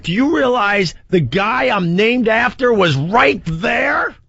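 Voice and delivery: high, drawn-out disbelief, retelling it; retold incredulous outburst. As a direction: stretch it out high, as if the fan missed everything. Stunned retelling to an oblivious fan.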